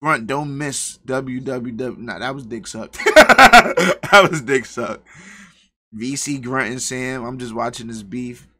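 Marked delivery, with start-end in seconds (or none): 3.0-5.1 loud burst of laughter into the words; cracking up. Real, not acted. Streamer amused by a chat message, cracking up. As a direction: laugh hard, then talk through it.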